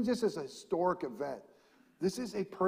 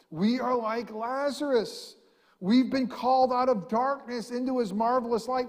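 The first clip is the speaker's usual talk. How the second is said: higher, louder, building proclamation; preaching at full force; 8 semitones higher, 6 dB louder than usual. Acted. Pastor proclaiming to the congregation, full-force preaching.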